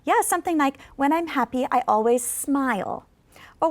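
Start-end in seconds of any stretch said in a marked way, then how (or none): 1.0-3.1 slow, falling on "smile"; slow model, down finish; slow falling intonation. Shown as a demonstration. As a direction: drop down on "smile". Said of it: "make your voice go down".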